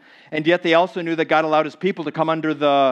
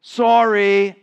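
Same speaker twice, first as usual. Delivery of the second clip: mock sorry sinner; 6 semitones higher, 7 dB louder than usual. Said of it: "committed sins that have invited hard consequences".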